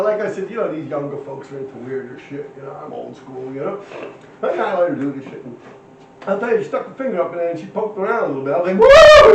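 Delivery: usual speaking voice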